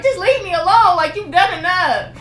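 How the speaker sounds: high, loud upset plea; upset leave me alone. Retold or imitated. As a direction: push him away with your voice. Repeats telling officer off.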